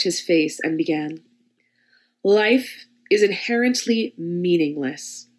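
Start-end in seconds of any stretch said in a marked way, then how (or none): 2.2-5.3 slow, deliberate, louder mistress voice; slow commanding dominatrix. Acted. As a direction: pronounce it like a cold verdict. Dominatrix begins the scene.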